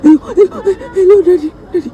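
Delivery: hammed-up sobbing call to daddy; fake crying on the phone. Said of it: "It's the drama I was performing on the call".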